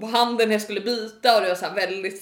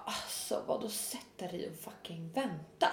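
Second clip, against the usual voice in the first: much softer, low incredulous echo; muted disbelief; 4 semitones lower, 12 dB quieter than usual. Retold or imitated. Customer told to wait, quietly incredulous. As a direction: retell it as an indignant aside under the voice. Speaker's disbelieving reply, retold.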